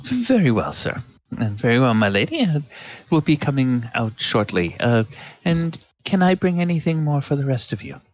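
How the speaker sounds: a hint of an eager, fussy waiter; lightly voiced servant listing desserts; eager server. Server eagerly offering puddings to the lady.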